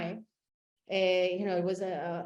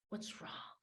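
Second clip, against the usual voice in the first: whisper; 18 dB quieter than usual. Retold or imitated. Gently asking a tired stranger.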